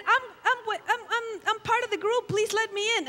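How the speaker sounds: high-pitched, stammering plea to be let in; stumbling, insistent retold plea; pleading. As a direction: imitate someone urgently begging entry. Retelling a man left behind pleading.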